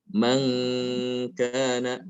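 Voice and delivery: "Man" held as a light nasal before "kana"; thin, light ghunnah. Shown as a demonstration. Teacher contrasts kaf with qaf, light held nasal. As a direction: keep the hum thin on "Man". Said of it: "gunahnya tipis".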